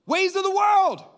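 loud, high-pitched cry of exasperation; outraged shout. Acted. Outburst at worldly attitude, later called angry.

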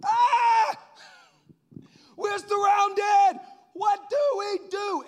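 very high, loud scream, words stretched out; panicking servant. Acted. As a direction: scream in hammed-up terror, slowly. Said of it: "respond the way the servant did".

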